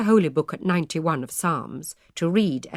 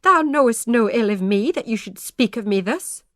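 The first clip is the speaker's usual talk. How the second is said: wounded protest; 4 semitones higher, 4 dB louder than usual. A narrator's reading. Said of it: "said poor Mistress Sheldon, very red".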